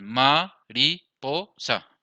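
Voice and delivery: "ma-ri-po-sa" in four separate beats; very slow teacher's syllable count. Shown as a demonstration. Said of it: "Tiene uno, dos, tres, cuatro sílabas".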